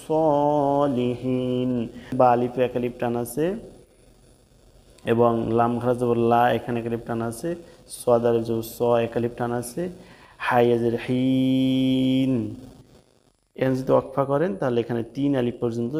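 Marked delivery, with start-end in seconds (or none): 0.1-1.7 drawn-out chanted Arabic, long vowels held; slow Quranic recitation. Shown as a demonstration. Teacher recites Arabic tajweed slowly. 10.5-12.3 drawn-out chanted Arabic, long vowels held; slow Quranic recitation. Shown as a demonstration. Teacher recites Arabic tajweed slowly.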